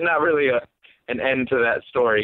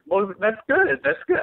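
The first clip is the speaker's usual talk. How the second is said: high, uncertain placating reply; retold awkward politeness; 9 semitones higher than usual. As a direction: act out humoring a stranger, unsure. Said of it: "I had no idea what to say".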